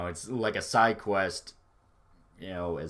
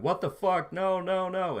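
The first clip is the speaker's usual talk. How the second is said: high-pitched, pleading panic at ordinary volume; mock freak-out; 10 semitones higher than usual. Father watching his son get shot, imitated freak-out. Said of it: "freaking out, going".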